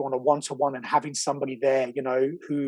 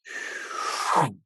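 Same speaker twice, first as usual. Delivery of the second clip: unvoiced long sigh out; long exhale; 2 semitones lower, 3 dB quieter than usual. Friend retelling how he sank into the pillow, long sigh. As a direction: let all the air go in a long sigh. Head hits pillow, breath out.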